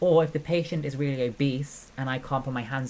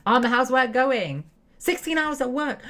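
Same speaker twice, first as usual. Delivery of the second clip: high, loud, eager hello; bright, loud welcome to a friend; excited greeting; 11 semitones higher, 6 dB louder than usual. Real, not acted. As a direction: call out to Mike with delight. Greeting coworker Mike in game.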